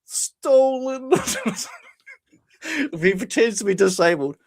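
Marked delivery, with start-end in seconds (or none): 0.0-1.1 high, put-on pitiful voice; pathetic. Roy answering his rescuers on the floor, pathetic. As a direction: imitate a man faking it, pitifully. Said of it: "He just talks, like, real pathetic".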